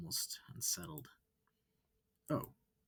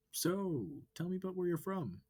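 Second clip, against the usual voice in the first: high, loud, eager wolf voice; bright curious alien asking questions; 11 semitones higher, 6 dB louder than usual. Acted. Amicus questioning the human, high and eager.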